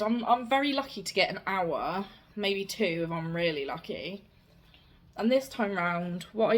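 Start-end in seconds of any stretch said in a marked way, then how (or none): none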